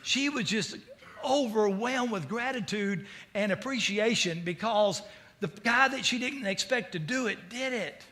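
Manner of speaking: ordinary talk, nothing marked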